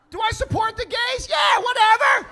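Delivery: high, loud, confused yell; confused shout through plexiglass; yelling back through glass. Retold or imitated. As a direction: shout back, not catching a word. Mishearing the cashier through glass.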